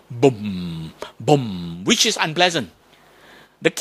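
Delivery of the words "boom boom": deep booming drum imitation. Shown as a demonstration. Teacher imitates a drum for the class, low booming. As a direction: boom low like a big drum. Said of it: "how does that compare to a drum".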